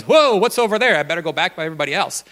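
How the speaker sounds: high, loud startled "Whoa" then a fast mutter; startled sheep. Retold or imitated. Sheep startled by a rock in the bush, high loud surprise then rushed. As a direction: voice a spooked sheep hurrying back, lightly cartoonish. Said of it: "the sheep goes".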